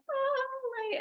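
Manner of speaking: very high, bright mock greeting; sweet high greeting. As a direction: squeak it like a cheerful welcome. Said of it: "it was like".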